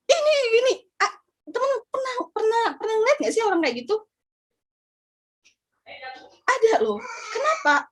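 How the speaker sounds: high, fast words through sobs; sobbing but forcing the question out; crying. Real, not acted. Breaks down while speaking. (menangis tersedu sambil bertanya)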